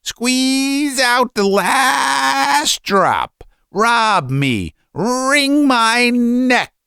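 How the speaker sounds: very high shriek with long-drawn vowels; martyred father shrieking to be robbed; shrill shout. A narrator's reading. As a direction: wail it out hysterically, very slowly. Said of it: "he shouted in a shrill voice".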